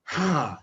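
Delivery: audible sigh from the diaphragm; deep breath out. Shown as a demonstration. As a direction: demonstrate a full, relaxed exhale. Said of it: "Breathe from your diaphragm".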